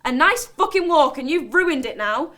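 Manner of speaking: high, loud blast easing into an aggrieved rant; angry outburst. Acted. Walker raging at the old bloke who scolded them, angry outburst. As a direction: explode on the first words, then rant on. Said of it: "I just get even more angry".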